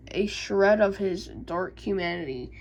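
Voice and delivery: ordinary talk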